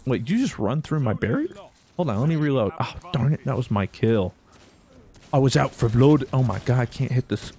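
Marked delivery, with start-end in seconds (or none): none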